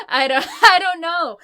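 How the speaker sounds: loud, high, through laughter; laughing helplessly. Real, not acted. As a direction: crack up while protesting. Cracks up, can't answer accent question.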